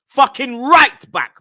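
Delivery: high, loud, slow outburst; outraged disbelief. Real, not acted. Fan incredulous at manager's substitutions, loud outrage. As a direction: shout it slowly in rising outrage. Outraged at player's position change.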